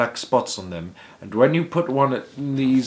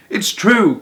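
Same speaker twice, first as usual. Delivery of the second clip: high, loud insistence; cried out; 10 semitones higher, 7 dB louder than usual. A narrator's reading. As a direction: cry out that it's true. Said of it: "cried all four of the old people".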